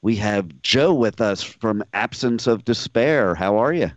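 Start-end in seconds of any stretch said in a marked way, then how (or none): none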